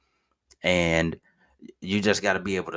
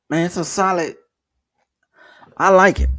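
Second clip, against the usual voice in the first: suddenly higher and louder, really pumped; excited; 11 semitones higher, 6 dB louder than usual. Real, not acted. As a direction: gush about it like you mean it. Enthusing about the account.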